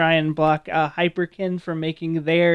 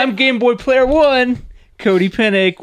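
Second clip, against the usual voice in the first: show announcer; 6 semitones higher, 5 dB louder than usual. Pretend. Host introduces self in mock intro.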